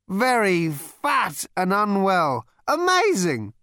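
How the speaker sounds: high, slow, loud tipsy voice; slurry drunken bonhomie; drunk Ford. A narrator's reading. Drunk Ford greets old mate.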